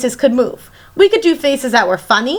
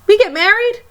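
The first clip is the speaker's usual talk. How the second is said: high, startled question; surprised; 9 semitones higher than usual. Acted. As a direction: caught off guard by the news. Surprised at future reveal.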